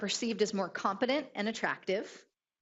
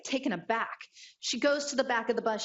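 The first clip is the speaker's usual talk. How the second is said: ordinary talk, nothing marked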